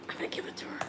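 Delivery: secret whispered aside. Real, not acted. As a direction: whisper it so she won't hear. Aside to viewers about gift.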